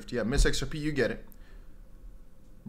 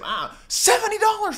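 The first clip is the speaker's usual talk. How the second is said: high-pitched mock astonishment; hammed-up shock at the sum; mock amazement; 3 dB louder than usual. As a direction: gasp out the figure in a put-on high voice. Acting out a huge tip reaction.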